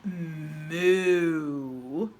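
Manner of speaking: lowing cow; a deep long moo. A narrator's reading. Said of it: "said the cow".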